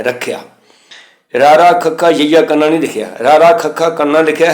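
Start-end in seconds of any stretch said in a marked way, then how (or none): 0.0-0.4 ਰੱਖਿਆ with a y glide after the kh; half-y pronunciation. Shown as a demonstration. Teacher showing the letter under kh, half-y pronunciation. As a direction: slip a short y into ਰੱਖਿਆ. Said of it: "ਖੱਖੇ ਦੇ ਥੱਲੇ ਵੀ ਅੱਧਾ ਯਈਆ ਹੈ".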